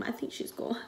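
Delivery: breathy whisper under her breath; whispered aside. Real, not acted. Whispering an aside to viewers about the girl. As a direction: drop to a secret whisper for a quick aside.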